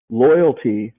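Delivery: ordinary talk, nothing marked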